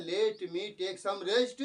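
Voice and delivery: very slow, even; slow dictation. Retold or imitated. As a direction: read slowly. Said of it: "है ना".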